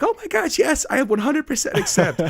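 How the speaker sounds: very high squealing excitement; giddy acceptance. Retold or imitated. Teacher gushing yes to the mock proposal, squealing high. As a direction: squeal it like a thrilled fiancée. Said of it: "jumped up and down and clapped her hands like".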